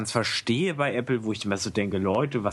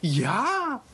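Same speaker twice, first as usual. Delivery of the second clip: excited; 9 semitones higher than usual. Real, not acted. Delighted at the news.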